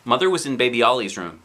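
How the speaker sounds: plain reading voice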